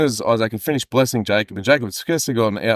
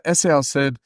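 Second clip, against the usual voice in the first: normal talking voice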